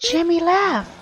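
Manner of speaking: high-pitched dismay; shocked realization. Real, not acted. Gamer sees Jimmy has left, shocked. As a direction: blurt it high in disbelief.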